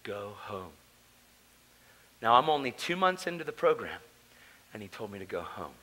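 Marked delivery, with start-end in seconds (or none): 0.0-0.7 low, hushed voice of God; a lightly voiced, hushed divine voice. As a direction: speak it low and soft like a divine whisper. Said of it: "his hand touched my shoulder and said".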